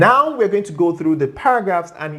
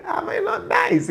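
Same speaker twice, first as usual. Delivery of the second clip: playful squeal. Real, not acted. Playful self-praise to viewers.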